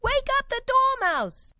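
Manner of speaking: high, loud, slow call. A narrator's reading. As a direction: shout to wake the sleeper. Both cry to wake Dormouse.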